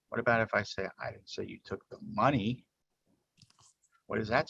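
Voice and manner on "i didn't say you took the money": "money" picked out at the end; stress on MONEY. Shown as a demonstration. Presenter asking what this version says, stress on "money". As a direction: save the weight for "money". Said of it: "by emphasizing different words".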